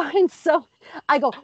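normal talking voice

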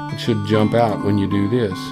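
ordinary talk

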